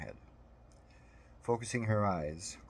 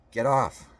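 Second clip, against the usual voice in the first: loud, higher-pitched yell; angry shout; 5 semitones higher, 11 dB louder than usual. A narrator's reading. Mother shouting at the man on her car roof, full voice. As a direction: shout it hard to drive someone off. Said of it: "she shouted".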